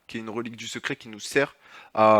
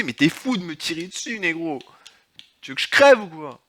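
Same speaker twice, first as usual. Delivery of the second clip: indignant shout; 5 semitones higher, 5 dB louder than usual. Real, not acted. Se fait tirer dessus.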